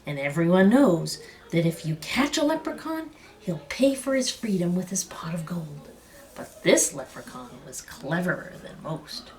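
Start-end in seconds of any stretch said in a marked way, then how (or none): none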